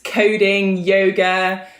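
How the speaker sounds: normal talking voice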